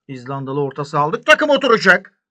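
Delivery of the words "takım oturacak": high, loud promise that the team will click; excited shout. Real, not acted. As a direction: belt it out with confidence. Hyping his new signing.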